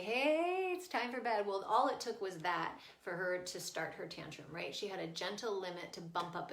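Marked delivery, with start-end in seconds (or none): 0.0-1.5 high sing-out on the opening, then easing; playful. Retold or imitated. Mom catches daughter for bed, playful. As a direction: playfully announce bedtime to a child. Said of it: "caught her in a playful way".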